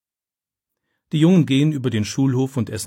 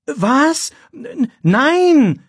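very high, louder startled cry; shocked gasp; 7 dB louder, much slower than usual. A narrator's reading. Boy cries out in shock at the teacher's charge, very high. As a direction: recoil in horror at the accusation. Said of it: "Philipp erschrickt".

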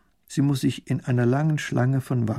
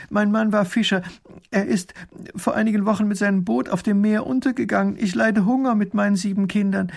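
high, urgent pleading; desperate; 9 semitones higher, 3 dB louder than usual. A narrator's reading. Widow tells the minister of her hunger, desperately. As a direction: plead your hardship in a high voice. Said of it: "ruft die Frau verzweifelt".

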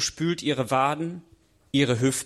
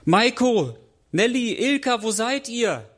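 a much higher, searching call across the ice; 8 semitones higher than usual. Acted. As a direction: call their names into the dark. Searching for friends at dusk. (suchendes, lautes Rufen)

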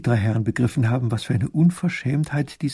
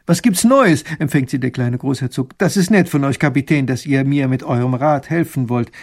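eager little grand duke; 5 dB louder than usual. Acted. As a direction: rush to meet them, hoping. Großherzog begrüßt Besucher. (gespannter kleiner Großherzog, höher und lauter)

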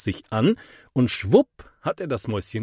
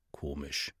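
much softer, inner-voice murmur; thinking to himself; 8 semitones lower, 15 dB quieter than usual. A narrator's reading. Boy wondering to himself about the neighbour, softly. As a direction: say it as a private thought. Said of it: "denkt Mathis".

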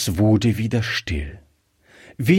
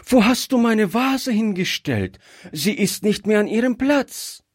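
put-on high-pitched, alarmed child's questioning; upset child wanting an explanation; startled child; 12 semitones higher than usual. A narrator's reading. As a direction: ask in dismay, high as a little girl. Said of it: "rief das Kind erschrocken".

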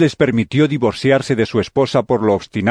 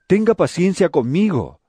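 high-pitched plea for patience; pleading; 6 semitones higher than usual. Acted. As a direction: beg for mercy, voice lifted high. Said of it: "le rogó". (súplica en tono agudo)

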